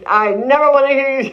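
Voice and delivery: sharp high retold scold, a hint of an angry father. Father scolds his daughter for the [name] song, high sharp mock scold. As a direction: bark the order at a child.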